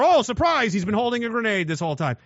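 spring the trap with glee; hammed-up sneaky reveal; mock gloating enemy.

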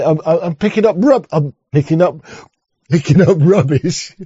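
lightly voiced London lad, proud of picking up rubbish; a touch of cockney bravado; mock London accent. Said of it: "You like that?".

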